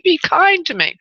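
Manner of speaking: high pleading repeat. Real, not acted. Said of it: "please, be kind to me".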